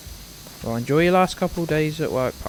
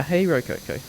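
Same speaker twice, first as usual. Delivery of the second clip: normal talking voice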